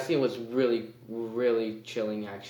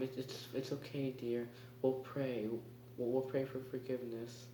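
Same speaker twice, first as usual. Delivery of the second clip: much softer, hushed soothing mother; 11 dB quieter than usual. Retold or imitated. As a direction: murmur it like a creepy pious mother. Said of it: "the mom is just like".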